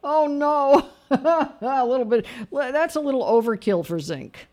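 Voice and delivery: high, loud, amused protest; laughing disbelief. Real, not acted. Host reacting to caller's zinc dose, laughing disbelief. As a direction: burst out laughing in mock alarm, pitched high.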